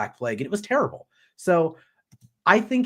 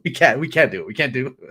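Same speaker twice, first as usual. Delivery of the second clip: ordinary talk, nothing marked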